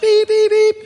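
high, loud beeping like a car horn; imitated car horn. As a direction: honk out each beep high and loud. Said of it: "car honking".

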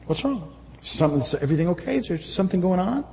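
soft, caring check-in; softly asking a stranger if they're okay; gentle concerned asking. Shown as a demonstration. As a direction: ask gently like you really care. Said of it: "But that's how I say it".

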